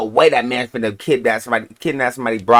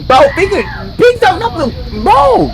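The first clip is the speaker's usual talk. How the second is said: loud, high urgent shout; yelling at the screen; 4 dB louder than usual. Real, not acted. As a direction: shout instructions at the video.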